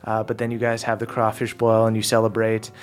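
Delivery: normal talking voice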